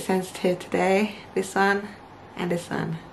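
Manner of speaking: pointing at teeth while talking; finger at mouth corner. Real, not acted. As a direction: lips pulled back grinning. Seen 0: Fingertip touches teeth at the mouth corner, lips drawn back.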